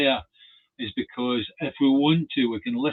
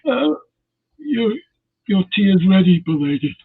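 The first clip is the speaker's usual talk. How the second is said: higher, louder eager servant; servant's voice; 4 semitones higher, 8 dB louder than usual. Retold or imitated. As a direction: announce tea like a devoted servant. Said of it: "put on my servant's voice".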